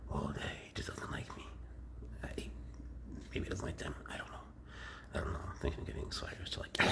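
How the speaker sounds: hushed aside; whispered confidences. Real, not acted. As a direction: keep it whispered and low.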